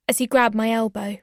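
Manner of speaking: usual speaking voice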